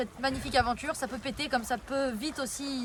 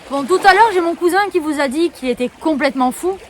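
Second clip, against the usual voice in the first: loud call across the distance; 13 dB louder than usual. Real, not acted. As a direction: shout so the cousin hears. Calling out to cousin outdoors. (appel à voix forte)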